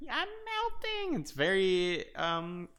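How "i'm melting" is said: high, drawn-out mock wail; melting villain. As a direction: slowly wail away like a dissolving witch. Imitating melting villain, Wizard of Oz callback.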